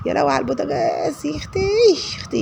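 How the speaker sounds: high-pitched exasperated cry; exclaiming. Real, not acted. A woman exclaims at the stove, high-pitched dismay. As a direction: squeal it out in dismay.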